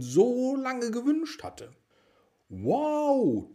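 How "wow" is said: high, loud delighted gasp of a word; joyful wow. A narrator's reading. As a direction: burst out in amazement. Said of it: "rief er voller Freude".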